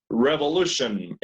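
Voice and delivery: "Revolution" said slowly, each syllable clear, ending "shon"; model pronunciation. Shown as a demonstration. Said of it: "Uh-huh".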